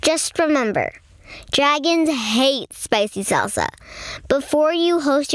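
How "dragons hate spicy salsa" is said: usual speaking voice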